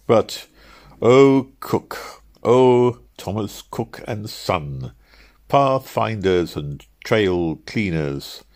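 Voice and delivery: slow, grandly declaimed apostrophe; mock-heroic oration. A narrator's reading. Traveller addressing Thomas Cook and Son, mock-heroic. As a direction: hail them like an orator, drawing out the phrases.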